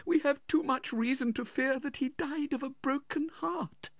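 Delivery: very high, grieving widow voice; tearful widow. A narrator's reading. Widow explains her husband's death to his brother, tearful. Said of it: "shedding tears".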